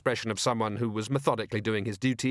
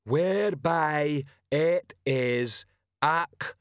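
slow, heavy troll voice pitched higher; troll sergeant announcing to the crowd; 4 semitones higher, much slower than usual. A narrator's reading. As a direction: spell it out slowly like a dim troll.

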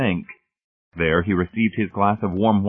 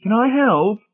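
very high, loud, coy offer; high, fluttery matron voice; 5 dB louder than usual. A narrator's reading. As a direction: flutter in coyly, pitched sky-high. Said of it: "fluttering coyly".

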